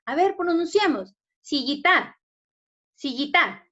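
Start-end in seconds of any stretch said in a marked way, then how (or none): 1.4-2.2 "Sillita" with the final TA punched; wrong stress on TA. Shown as a demonstration. Teacher testing the wrong syllable, stress on TA in "Sillita". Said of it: "mayor fuerza de voz está en la ta". 2.9-3.7 "Sillita" ending hard on TA; wrong stress on TA. Shown as a demonstration. Teacher repeating the mistake for pupils, stress on TA in "Sillita". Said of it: "mayor fuerza de voz está en la ta".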